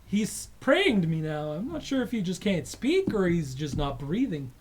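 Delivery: guard reports the human to Boral, baffled; alien guard explaining. Acted. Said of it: "the guy at your station is like".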